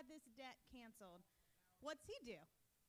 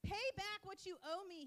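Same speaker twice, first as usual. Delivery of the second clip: forceful reading; 6 semitones higher, 6 dB louder than usual. Acted. Reading violent scripture scene.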